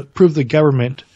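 ordinary talk

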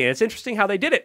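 ordinary delivery

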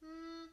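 high, soft "hmm"; considering hum. A narrator's reading. Teacher weighing the class's request, doubtful hum. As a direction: hum as if unsure. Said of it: "said Miss Perks".

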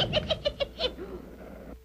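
a short high cackle; witch cackle. Acted. Witch cackling after cursing the princess. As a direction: cackle with glee at the curse.

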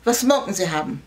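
gruff German demand; a hint of a bullying German waitress; belligerent German. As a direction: bark it like a surly German waitress. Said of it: "frightened her with a belligerent".

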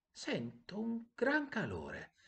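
slow, high-pitched marvelling; drawn-out, high wonder. A narrator's reading. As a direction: savour the surprise in a small bird's voice. Swallow marvels at feeling warm.

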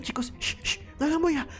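usual speaking voice

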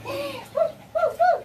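high excited woo sounds; a burst of playful hoots. Acted. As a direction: coo high, wuh wuh wuh, to amuse the baby. Playful call to baby.

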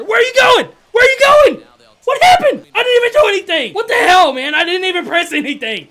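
very high, loud indignant yelling; furious disbelief at the glitch; outraged shout. Real, not acted. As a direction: protest at the game. Runner moves without his input.

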